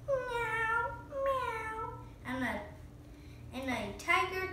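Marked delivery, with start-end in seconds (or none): 0.1-1.9 high meowing cat. Pretend. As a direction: meow like a cat. Cat noise in story.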